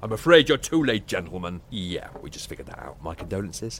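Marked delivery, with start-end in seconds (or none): 0.0-1.6 dramatic declaration. Acted. Dramatic lord announcement.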